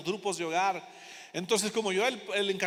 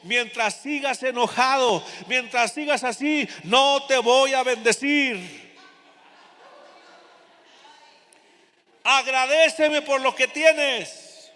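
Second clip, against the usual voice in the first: slow, loud and solemn divine voice; booming, stretched out, like a voice from heaven; voice of the Holy Spirit; 4 semitones higher, 8 dB louder, slower than usual. Acted. Holy Spirit rebuking the angry pastor, loud, slow and solemn. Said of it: "escuché la voz del Espíritu Santo que me dijo".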